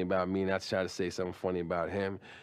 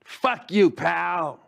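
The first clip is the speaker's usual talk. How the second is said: Daryl's insult; 4 dB louder, much slower than usual. Retold or imitated. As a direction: savor the insult slowly, then snap it out. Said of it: "the way he would say moron".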